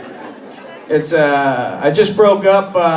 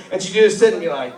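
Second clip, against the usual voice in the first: ordinary delivery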